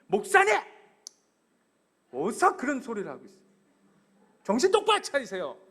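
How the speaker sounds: an indignant shout of reproach; sharp rebuke. Acted. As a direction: scold the pastor like a fed-up disciple. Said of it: "제자가 스승을 꾸짖어".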